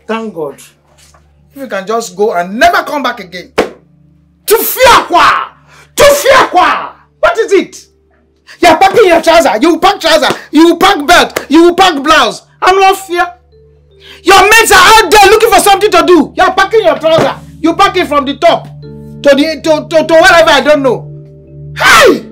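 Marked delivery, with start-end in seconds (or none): 3.5-7.0 drawn-out exasperated yell at a son; scolding shout. Acted. Mother yelling at her son packing to leave, drawn-out scolding shout. 21.8-22.2 sudden high yelp of protest; sharp cry. Acted. Mother crying out at her son, sudden high yelp.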